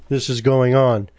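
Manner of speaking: ordinary talk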